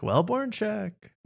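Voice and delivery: "wellborn check" high and slow; drawn-out pun. Shown as a demonstration. Wordplay on wellness check.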